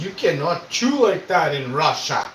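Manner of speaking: slow, stern mock Russian accent; imitating a gruff Russian viewer. Russian guy scolding on stream.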